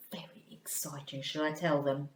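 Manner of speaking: ordinary talk, nothing marked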